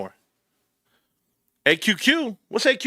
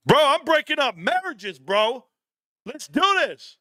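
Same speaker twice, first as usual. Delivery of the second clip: higher, hyped-up boast; excited; 11 semitones higher than usual. Real, not acted. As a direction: gloat with rising excitement. Gleeful boast about comments.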